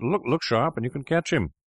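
high-pitched urgent call; landlord's urgent cry. A narrator's reading. Landlord hurrying Fettes after the doctor, urgent cry. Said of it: "cried the landlord".

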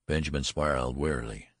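usual speaking voice

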